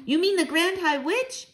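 put-on high-pitched eager child's question; excited cry. A narrator's reading. Child to Grandmama about the witch, excited cry. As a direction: pipe up high, amazed at the guess. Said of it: "I cried".

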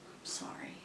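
barely audible, whispered regret; very soft, voiceless murmur; hushed apology. Acted. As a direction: let the apology out under your breath. Apologising to rejected suitor.

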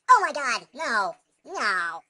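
very loud falling cry; horrified outburst. Real, not acted. Sudden disaster in game.

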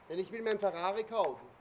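ordinary talk, nothing marked

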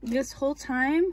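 can't believe she could have looked all along; disbelieving outburst. Real, not acted. To viewers, unboxing a bag she could see through, loud disbelief.

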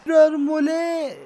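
high, slow, drawn-out cry; amazed exclamation. Real, not acted. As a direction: marvel at the move out loud. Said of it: "Soruya bakar mısınız?".

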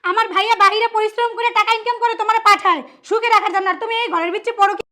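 loud indignant scolding; angry tirade. Acted. Woman shames cheating sister-in-law for brother's sake, angry tirade.

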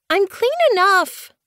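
squealed, very high protest; protesting kitten. A narrator's reading. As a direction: squeak it out, fed up with grooming. Kitten protests being groomed.

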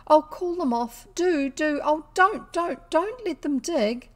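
high, desperate pleas, slowing at the end; anguished pleading. A narrator's reading. Girls beg the man to call off his dogs, high and desperate. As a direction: beg them to stop the dogs, near tears. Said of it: "jumps of unrestrained anguish and saying".

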